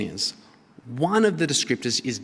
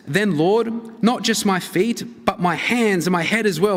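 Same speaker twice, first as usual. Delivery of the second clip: eager Peter; 6 semitones higher than usual. Retold or imitated. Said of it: "Peter says".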